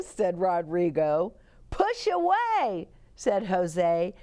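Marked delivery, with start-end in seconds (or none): 1.7-2.9 high, excited call; eager boy. A narrator's reading. Jose on the swing.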